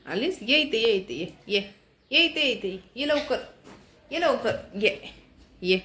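quiet coaxing call; soft coaxing. Real, not acted. Vlogger softly coaxing the girl to come close. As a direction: coax her closer gently and softly.

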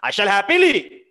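loud, high bitter "happily"; angry sarcasm. Acted. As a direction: say "I shall happily" through fury, loud. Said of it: "He's very angry".

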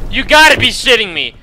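loud, indignant disbelief; angry disbelief. Real, not acted. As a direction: protest loudly, not yet at full yell. Gamer in disbelief after dying.

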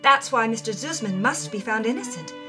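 ordinary delivery

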